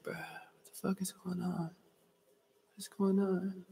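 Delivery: his own confused younger self, asking under his breath; a baffled question thrown out half to himself; retold confusion. Kid in remedial class, baffled and quiet. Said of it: "And I was like".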